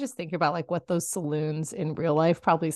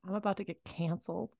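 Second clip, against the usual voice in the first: usual speaking voice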